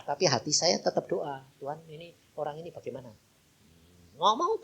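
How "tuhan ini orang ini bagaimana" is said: quick, soft, hushed inner prayer. Retold or imitated. Pastor praying inside during the call, hushed. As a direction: pray it silently under your breath. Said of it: "Dalam hati itu saya ngomong".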